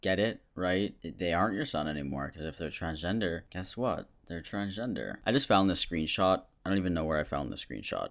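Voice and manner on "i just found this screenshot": ordinary delivery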